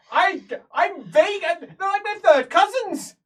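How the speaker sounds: loud, high, drawn-out shouting; shrill protest. Acted. Game master voicing an indignant cousin, shrill shout. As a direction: protest at the top of your voice. Same acted quarrel, protesting.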